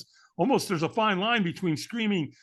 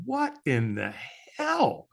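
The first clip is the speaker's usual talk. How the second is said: drawn-out disbelief, leaping high on the last word; pause, then punch HELL; stunned disbelief; 4 semitones higher, much slower than usual. Retold or imitated. Firewalker's brain on seeing people walk on fire, stunned disbelief.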